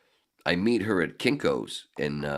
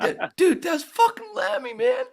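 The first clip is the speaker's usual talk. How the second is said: very high, urgent insistence; frantic urging; 5 dB louder, slower than usual. Retold or imitated. Telling [name] who [name] is, frantically high. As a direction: grab your friend's attention.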